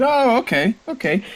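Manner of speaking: high-pitched wizard reply; wizard character. Acted. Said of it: "And he says".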